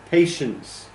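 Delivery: patience with high stress on PA, then falling; first-syllable stress. Shown as a demonstration.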